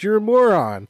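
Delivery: retold insult. Retold film insult delivered.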